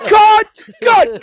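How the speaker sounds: sharp high shout of a fed-up director; director yelling cut. Acted. Director stopping the actor's take, a high shout. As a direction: bark it like you're stopping the take.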